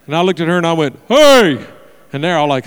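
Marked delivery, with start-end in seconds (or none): 1.1-1.6 loud, high one-word shout; hollered greeting. Retold or imitated. Woman greeting pastor mid-tour, hollering. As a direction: holler hello across a hall.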